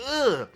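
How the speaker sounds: loud, high "ugh"; short burst of exasperated disgust; disgusted groan. Real, not acted. Reviewer recoiling from forced movie quips, disgusted groan.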